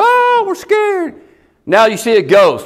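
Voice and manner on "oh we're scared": high, drawn-out scared wail; hammed-up frightened cry, stretched out; mock terror. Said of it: "They were terrified".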